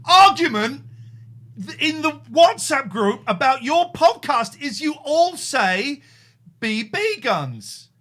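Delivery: loud, slow, laughing rant; laughing indignation. Real, not acted. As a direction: laugh through the complaint.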